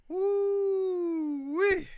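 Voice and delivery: high, loud ooh-wee; excited high whoop. Real, not acted. Admiring uniforms.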